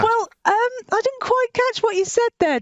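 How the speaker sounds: playful high-pitched teasing. Pretend. Teasing puppet's failed ventriloquism.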